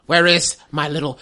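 slow, lightly voiced witch; a touch of a scheming crone; old hag. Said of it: "the witch".

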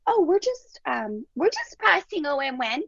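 put-on high, sweet toddler voice; a little girl chirping innocently; little three-year-old voice. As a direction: talk like a tiny girl explaining her game. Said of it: "all in this little three-year-old voice".

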